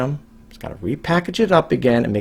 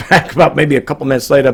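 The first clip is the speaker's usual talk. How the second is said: normal talking voice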